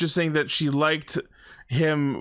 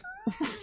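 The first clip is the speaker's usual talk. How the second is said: high squeaky laugh; 11 dB quieter than usual. Real, not acted. Laughing at sponsor joke.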